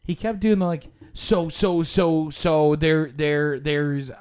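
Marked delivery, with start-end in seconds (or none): 1.1-4.1 mock stammering tour guide; stuttering instructor. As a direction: repeat words, stuck on each one. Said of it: "this instructor who stutters a lot".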